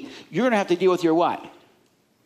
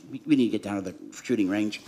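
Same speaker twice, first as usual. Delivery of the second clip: grumbling inner voice; 9 semitones lower, 5 dB quieter, faster than usual. Retold or imitated. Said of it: "there's a voice in your head that goes".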